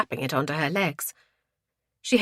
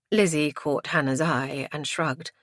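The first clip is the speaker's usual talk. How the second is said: plain reading voice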